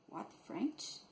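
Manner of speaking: ordinary delivery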